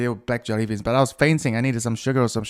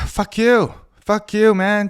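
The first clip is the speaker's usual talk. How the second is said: fed-up outburst; 8 semitones higher, 6 dB louder, slower than usual. Real, not acted. Ranting about control.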